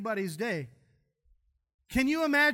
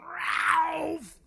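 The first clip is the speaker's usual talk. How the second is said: loud, higher mock vomit noise; imitating retching; 6 semitones higher, 5 dB louder than usual. Preacher imitates his wife being sick, loud mock retch. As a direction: belt out a fake heave. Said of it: "she's by a metal building going".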